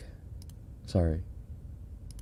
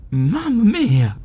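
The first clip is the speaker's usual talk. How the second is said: mock Italian exclamation; 12 semitones higher, 7 dB louder than usual. Mimics clip's Italian exclamation.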